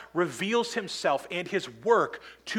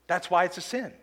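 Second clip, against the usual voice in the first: ordinary talk, nothing marked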